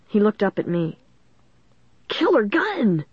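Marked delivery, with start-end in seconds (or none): 2.1-3.1 high, gleeful exclamation; thrilled outburst; excited exclamation. A narrator's reading. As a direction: exclaim it in awe, pitch way up. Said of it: "he exclaimed".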